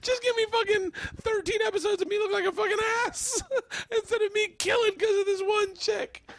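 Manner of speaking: high, squealing mock plea; pleading through laughter. Comic begging to have the episode cut, pleading through laughter. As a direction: beg desperately while cracking up. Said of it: "Jay was like".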